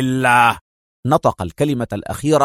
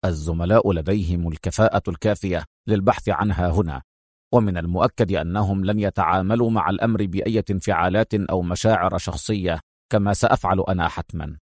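firm, hiding emotion; 6 semitones lower, 5 dB quieter than usual. Acted. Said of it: "بنفس الحزم".

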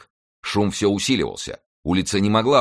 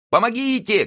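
very high desperate cry for help; old servant's scream; 3 dB louder than usual. A narrator's reading. Old servant screaming for help, very high desperate cry. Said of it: "кричала старая служанка".

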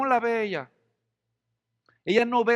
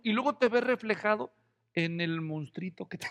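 ordinary talk, nothing marked

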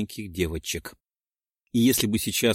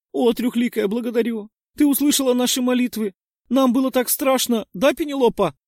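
panting shaky eyewitness; 13 semitones higher, 6 dB louder than usual. A narrator's reading. Girl feigning fright and gratitude.

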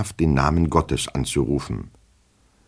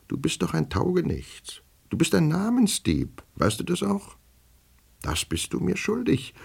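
higher and softer, chummy teasing; familiar tipsy tone; 5 dB quieter than usual. A narrator's reading. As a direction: tease your drunken double like an old pal. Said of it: "fuhr er in familiärem Ton fort". (vertraulich neckend, höher und leiser)

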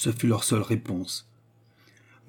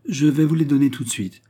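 mildly alarmed, higher and louder; alarmed exclamation; 5 semitones higher, 5 dB louder than usual. A narrator's reading. Frightened host promising his guests payment at once, alarmed exclamation. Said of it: "s'écria l'hôte effrayé".

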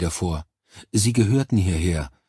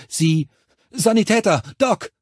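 hoch und laut nach dem Sanitäter rufen; dringender Ruf; 12 semitones higher, 5 dB louder than usual. Acted. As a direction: ruf quer übers Feld nach dem Doc. Rogers ruft den Sanitäter herbei. (Rogers ruft laut und hoch nach dem Sanitäter)

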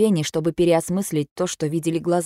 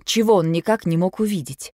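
ordinary delivery

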